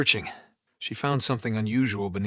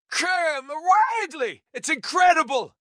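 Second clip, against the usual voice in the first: thrilled; 6 dB louder, slower than usual. Acted. Kramer thrilled by discovery.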